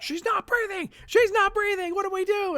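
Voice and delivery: shrieked way up high and loud in panic; panicked screaming. Acted. Partygoers shrieking over a collapsed singer, panicked. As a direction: scream it, terrified, far above your voice. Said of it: "stressed out sort of screaming".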